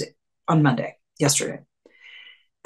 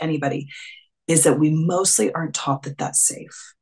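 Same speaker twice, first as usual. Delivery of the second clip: usual speaking voice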